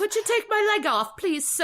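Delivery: put-on high, pleading English urchin accent; Oliver Twist. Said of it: "I channel Oliver Twist".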